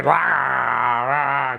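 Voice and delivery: slow, gritty growled rah rah. Shown as a demonstration. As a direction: growl the rah like a dirty sax tone. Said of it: "as you can hear, it's got a real".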